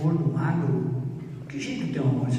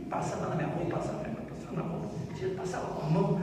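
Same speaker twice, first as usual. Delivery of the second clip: hushed tender murmur; 10 dB quieter, much faster than usual. Retold or imitated. Tender memory of holding children.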